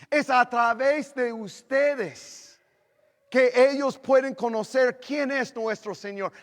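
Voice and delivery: loud, high, slow preaching build; fiery preaching. Acted. Pastor urging the church to be light.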